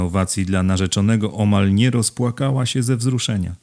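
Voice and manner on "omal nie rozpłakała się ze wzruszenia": ordinary delivery, nothing marked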